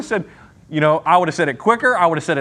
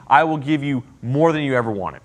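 ordinary talk, nothing marked

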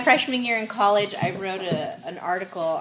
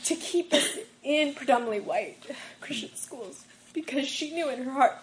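emotional; 7 semitones higher than usual. Real, not acted. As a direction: voice pitched up and strained with real emotion. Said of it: "Even now I'm getting a little emotional".

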